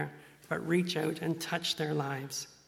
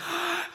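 sharp gasp of shock. Retold or imitated. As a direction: gasp like you just caught him. Disciples shocked at Jesus.

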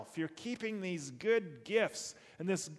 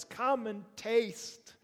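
slow, higher inviting call; calling others; 6 semitones higher, 3 dB louder, slower than usual. Pretend. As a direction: draw them in, slowly. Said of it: "calling others".

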